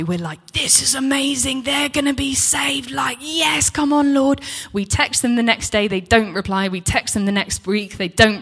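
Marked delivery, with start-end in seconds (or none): 0.5-4.4 high, excited cheer; elated. Retold or imitated. Couple on a high after guests leave, elated. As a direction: celebrate eagerly, pitch held up high. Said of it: "we're on a high".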